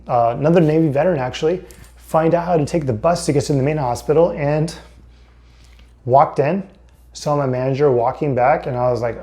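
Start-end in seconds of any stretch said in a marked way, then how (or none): none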